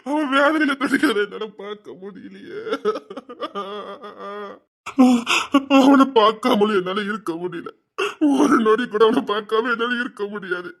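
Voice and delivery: wailing lament with sobs between phrases; crying. Acted. Father unable to bear a loved one's suffering, crying. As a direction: cry out in anguish, then sink away. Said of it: "உட்கார்ந்து அழுதுட்டு இருக்கீங்க".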